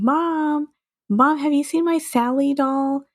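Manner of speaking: put-on high calling-out voice, kid. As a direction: call for mom from another room. Narrating Hannah calling out.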